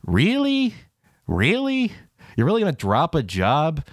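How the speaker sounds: high-pitched, drawn-out disbelief; incredulous. Real, not acted. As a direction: question it slowly, pitch shooting up. Disbelief at her quitting.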